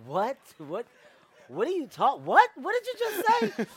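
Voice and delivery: high, loud, incredulous retort; hammed-up bewilderment, rising to a squawk; mock disbelief. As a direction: react as if you can't believe your ears. Retelling his shocked reply to friend.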